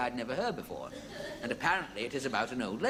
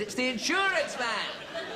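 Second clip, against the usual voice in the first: slow, raised call through a letterbox; mock shout; 11 semitones higher, 3 dB louder, slower than usual. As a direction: call through the door, getting fed up. Insurance man shouts through letterbox.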